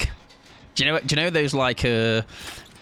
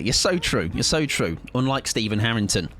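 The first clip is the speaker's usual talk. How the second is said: ordinary talk, nothing marked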